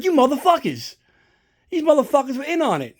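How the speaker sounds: high-pitched retold indignation; mock outrage. Retells outrage at being pranked.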